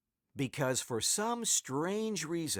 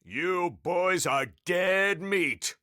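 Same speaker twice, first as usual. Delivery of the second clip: slow, higher-pitched sneering threat; menacing sneer; 5 semitones higher, 4 dB louder, slower than usual. A narrator's reading. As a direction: draw the threat out with relish. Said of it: "he sneered".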